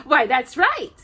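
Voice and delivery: dwarf chuckling with glee; light gleeful laugh; gleeful chuckle. A narrator's reading. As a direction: laugh as happy Happy. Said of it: "Happy chuckled in glee".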